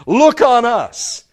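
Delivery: loud, high, drawn-out call; bold, slow summons to attention; confident command. Retold or imitated. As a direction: stretch it out with total confidence. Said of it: "they say with confidence".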